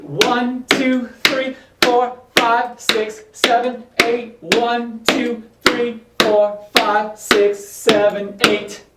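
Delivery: rhythmic count called to the beat; counting dance beats. Shown as a demonstration. Counting beats for tap drill.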